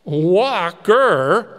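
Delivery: put-on loud, incredulous street boy; the boy scoffing at the old man's order; boy's exclamation. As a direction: blurt it out in disbelief. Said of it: "exclaimed the boy".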